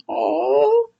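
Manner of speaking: a sudden high catch in the voice; real tearful "oh"; moved to tears; choked-up gasp. Real, not acted. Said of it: "emotional reaction".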